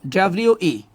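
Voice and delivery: normal talking voice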